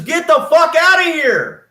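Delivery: high, loud yelled eviction; retold furious yell; furious shout. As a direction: throw him out at full volume. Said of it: "she says".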